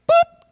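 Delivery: loud, high-pitched boop; squeaky editing sound effect; imitated cut sound. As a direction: pop out a quick, high boop.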